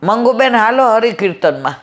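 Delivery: calling out. Retold or imitated. Neighbour calling Mangubehn to hymn singing, high mock call. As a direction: call her neighbour to the evening hymns. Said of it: "એ બૂમ પાડે".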